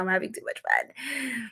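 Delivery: usual speaking voice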